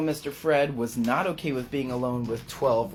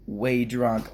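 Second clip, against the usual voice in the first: usual speaking voice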